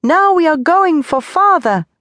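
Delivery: put-on high, loud child's shout. A narrator's reading. Tony shouting with joy beside his mother, high and loud. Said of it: "shouted once more".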